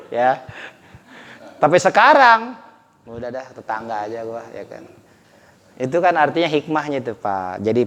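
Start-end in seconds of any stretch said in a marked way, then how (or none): 3.1-4.8 low, soft aside; muted, lowered acted shrug; mock resignation. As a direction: give in quietly, as if settling for less. Acted resigned shopper, settling for neighbour.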